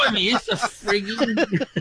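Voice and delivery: high, laughing Cockney voice; Cockney accent. Acted. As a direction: go broad Cockney while cracking up. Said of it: "Cockney, Cockney rhyming slam".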